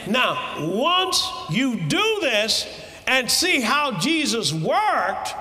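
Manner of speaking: shouting preacher building to a climax; fiery preaching. Acted. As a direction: preach it at full force to the congregation.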